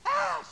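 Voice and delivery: high, very loud imitated wail; mock holler. Preacher imitating daughter at testimony meeting, hollering. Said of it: "she hollered".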